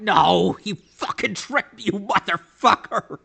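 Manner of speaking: high, loud furious yelling; angry shout. Acted. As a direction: scream at the man who tricked you. Angry at the forger.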